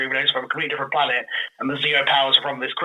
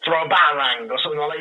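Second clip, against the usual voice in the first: usual speaking voice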